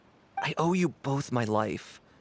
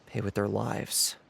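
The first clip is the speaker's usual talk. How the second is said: slow grave verdict; 5 semitones lower, slower than usual. Acted. Pronouncing death sentence.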